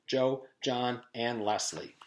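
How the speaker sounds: ordinary delivery, nothing marked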